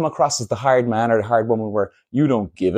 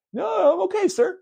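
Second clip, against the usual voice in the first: mock meek reply. Acts out reply to orderly.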